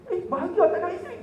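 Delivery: quick, high-pitched incredulous question; mock disbelief. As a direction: question his claim in comic astonishment. Incredulous reply to contented widower.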